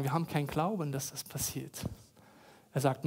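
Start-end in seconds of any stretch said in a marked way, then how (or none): 0.0-1.8 softly retold tearful doubt; weinend, nacherzählt. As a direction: trail down quietly, without real crying. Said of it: "weinen und sagen". (leise nachgespieltes, mutloses Weinen)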